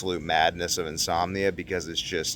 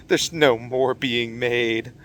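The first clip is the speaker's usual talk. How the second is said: slow mournful; 4 semitones higher, much slower than usual. Retold or imitated. Sad punchline.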